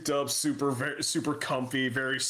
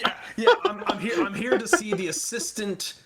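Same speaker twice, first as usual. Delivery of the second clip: higher, louder, laughing through a mocking quote; 10 semitones higher, 5 dB louder than usual. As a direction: laugh while parroting DeLong's boast. Mocking DeLong's claim, laughing.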